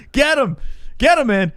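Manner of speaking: high, loud cheering on; egging on. Real, not acted. Host eggs on a friend to hit back, loud excited cheer. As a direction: whoop him on to hit back.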